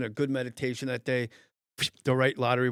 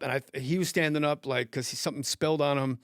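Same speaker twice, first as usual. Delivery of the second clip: normal talking voice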